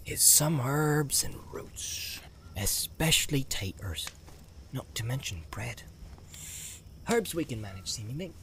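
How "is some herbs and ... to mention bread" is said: slow, whispered musing; to himself. A narrator's reading. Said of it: "he said to himself".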